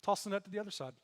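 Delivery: offhand, acted instruction; casual suggestion. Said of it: "He just casually goes".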